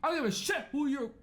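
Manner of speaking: high-pitched, worked-up comeback; mock indignant retort. Grandson tells off grandparent as the king, mock fury. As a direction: snap back in a high mock tantrum. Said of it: "You tell him".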